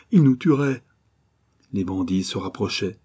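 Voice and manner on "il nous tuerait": higher, louder anxious plea; narrated entreaty, pitch lifted; pleading. A narrator's reading. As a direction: plead urgently as a narrated character. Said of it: "murmura Mayana suppliante".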